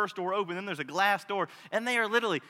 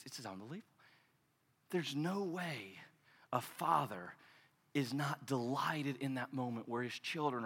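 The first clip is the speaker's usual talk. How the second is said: much softer and lower, heartfelt; tender; 5 semitones lower, 9 dB quieter than usual. Real, not acted. A father telling the church of his delight at his children, softer and lower. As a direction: let it sink in gently.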